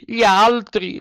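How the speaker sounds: the cat chimes in loudly; cat echoing. A narrator's reading. Cat echoes the fox's noble claim, high and loud. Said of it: "ripeté il gatto".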